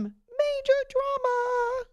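high, drawn-out theatrical croon; mock drama. Host teasing a Cardinals story, with mock drama.

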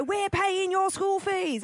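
high, slow, drawn-out parental complaint; hammed-up aggrieved parent; scolding parent. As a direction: imitate an indignant parent laying on guilt. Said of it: "and your parents go".

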